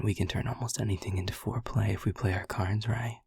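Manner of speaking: soft teasing whisper; flirty whisper. Acted. Incubus teasing the listener about movies, whispered. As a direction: breathe it close into the ear.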